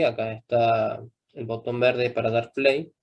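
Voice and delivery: usual speaking voice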